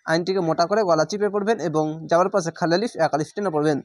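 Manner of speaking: usual speaking voice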